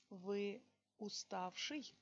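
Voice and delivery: ordinary talk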